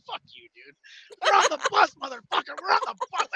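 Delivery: high, crowing taunt; mock triumphant taunt; gleeful catchphrase. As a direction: crow it like a victory cry. Re-shouting the catchphrase with glee.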